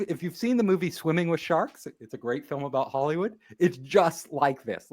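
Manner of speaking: ordinary delivery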